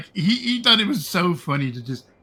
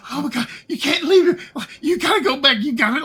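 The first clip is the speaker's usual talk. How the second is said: much higher and louder, frantic pleading; panicked girlfriend; 11 semitones higher, 4 dB louder than usual. Retold or imitated. Girlfriend in the back seat pleading to go back, high frantic voice. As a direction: beg him to turn back in a panic. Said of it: "she is just losing her shit".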